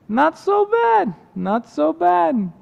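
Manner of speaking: put-on very high, slow, lilting accented voice; contented water carrier. Character now content, echoes his complaint.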